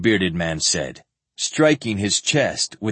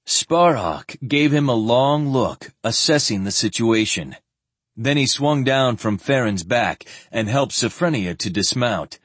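ordinary delivery

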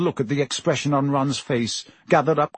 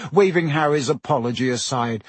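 usual speaking voice

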